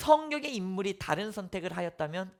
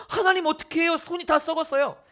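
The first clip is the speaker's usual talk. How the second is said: high, loud alarmed cry; preacher voicing a frightened Moses; panicked Moses; 8 semitones higher, 4 dB louder than usual. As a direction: cry out in dismay at a rotting hand. Moses panics at his rotting hand.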